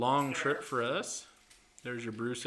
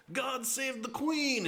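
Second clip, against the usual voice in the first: put-on much higher, slow British toff accent; 13 semitones higher, slower than usual. British guy jumps from the plane, hammed-up British accent. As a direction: noble British sacrifice, hammed up. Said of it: "he has the thick British accent".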